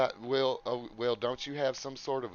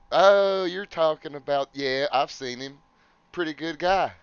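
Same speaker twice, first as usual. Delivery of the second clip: excited; 5 semitones higher, 9 dB louder than usual. Acted. Character recognizes Bigsby eagerly.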